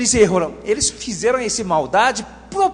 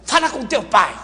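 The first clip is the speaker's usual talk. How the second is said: put-on curt parent passing the child along; mock-cross "go ask your father" voice; quarrelling mother's brush-off. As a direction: act a miffed mother deflecting her child. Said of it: "Aí você fala assim".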